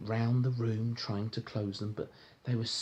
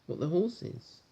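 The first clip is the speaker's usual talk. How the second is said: ordinary delivery, nothing marked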